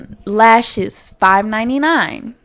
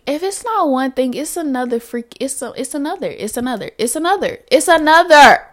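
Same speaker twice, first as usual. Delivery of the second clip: building from muttering to a loud cry; retold exasperation; 5 semitones higher, 5 dB louder than usual. Losing her hair, building to a loud outcry. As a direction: rise into a frustrated outburst.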